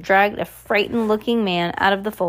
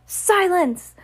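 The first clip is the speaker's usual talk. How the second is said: high-pitched order for silence; sharp command; 9 semitones higher than usual. A narrator's reading. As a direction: command the room in a raised voice. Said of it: "commanded one of the m".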